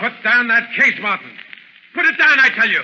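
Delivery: high, loud threat building to a yell; villain screaming at his henchman before he shoots; furious shout. Acted. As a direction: order him to drop it or else.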